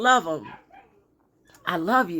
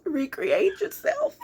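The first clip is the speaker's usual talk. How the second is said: high, slow, put-on whine; silly high voice; 10 semitones higher, slower than usual. Reader jokingly giving the day's advice, silly high voice. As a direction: draw it out high and playful. Silly voice, then "let me stop.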